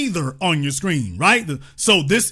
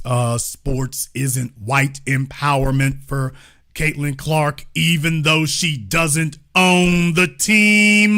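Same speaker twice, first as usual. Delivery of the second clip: deep, slow, mocking question; mock low voice; 6 semitones lower, slower than usual. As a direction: drop the voice low and drag out the question.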